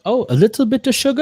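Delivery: high, silly mimicry of the worker; mock barista. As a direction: squeak it up like a clueless barista. Mimicking barista mishearing order.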